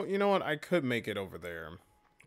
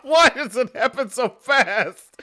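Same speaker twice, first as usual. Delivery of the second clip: much higher, louder yelling through laughter; laughing shout; 9 semitones higher, 10 dB louder than usual. Real, not acted. Player yells at his partner over the gasoline bucket, laughing. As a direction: scold through helpless laughter.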